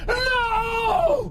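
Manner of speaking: very high, crying scream of horror; despairing scream. Acted. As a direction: scream as the end comes. Villain facing the bomb.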